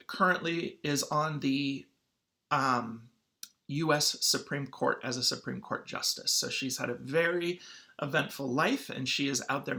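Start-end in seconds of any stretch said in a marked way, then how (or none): none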